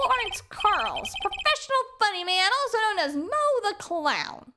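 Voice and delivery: high-pitched showman clown; hammy, high clown voice; clown introducing himself. Acted. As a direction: announce yourself as a funny man.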